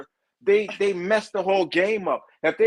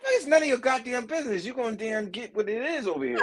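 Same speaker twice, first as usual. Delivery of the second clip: quick, higher, defiant street retort; New York comeback; 7 semitones higher, faster than usual. Retold or imitated. Man shows how New Yorkers actually answer, quick defiant retort. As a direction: fire back the way a New Yorker really would.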